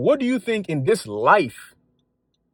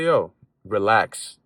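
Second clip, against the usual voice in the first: calm reassurance; 6 semitones lower, much slower than usual. Retold or imitated. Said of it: "And he's just like".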